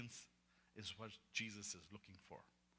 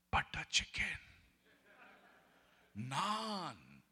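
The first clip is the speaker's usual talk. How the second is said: louder, higher cry of wonder; amazed; 7 semitones higher, 8 dB louder, much slower than usual. Real, not acted. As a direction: gasp out the discovery in awe. Amazed at food found.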